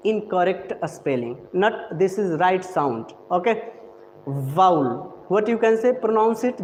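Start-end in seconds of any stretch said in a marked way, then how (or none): 4.5-4.9 Vowel said as "vaul", low and falling; teacher models Vowel; Vowel clipped to one falling syllable; correct pronunciation. Shown as a demonstration. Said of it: "this is the right pronunciation".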